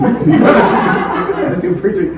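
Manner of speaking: giggling through the words; laughing. Real, not acted. Speaker laughing while coaching preachers. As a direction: laugh while you explain. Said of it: "Stop preaching at back".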